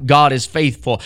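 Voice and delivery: ordinary talk, nothing marked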